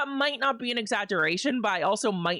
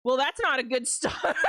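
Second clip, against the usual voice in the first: ordinary delivery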